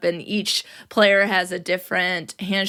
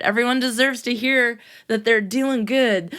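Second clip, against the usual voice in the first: usual speaking voice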